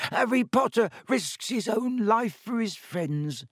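high, slow house-elf moan, torn between misery and rapture; a tearful elf moaning in awe; miserable ecstasy. A narrator's reading. Dobby moaning to Harry in miserable ecstasy. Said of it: "moaned Dobby in a kind of miserable ecstasy".

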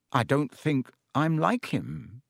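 ordinary delivery, nothing marked

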